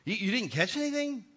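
teasing question. Retold or imitated. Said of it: "what he's saying".

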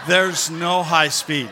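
slow and loud, laughing through it. Real, not acted. Telling the congregation about the slow cart, slow laughing delivery. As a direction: drag it out between laughs.

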